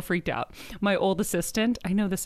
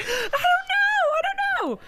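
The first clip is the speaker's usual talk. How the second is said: much higher flustered squeak; 4 dB louder than usual. Retold or imitated. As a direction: squeak it out helplessly. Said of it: "I'm like".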